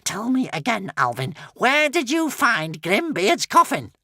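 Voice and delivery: urgent witch. A narrator's reading.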